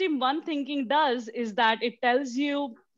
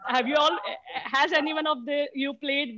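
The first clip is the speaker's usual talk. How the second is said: laughing while talking; 6 semitones higher than usual. Real, not acted. Laughing while asking question.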